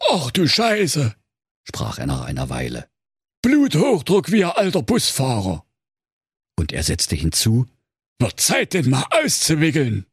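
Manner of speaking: high, self-assured expert prince; know-it-all medic voice. Acted. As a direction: lecture like a smug professional. (besserwisserischer Sanitäter)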